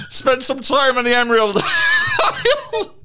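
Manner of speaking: high, slow Irish lilt breaking into laughter; hammed-up Irish, cracking up; mock Irish. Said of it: "Why is he Irish?".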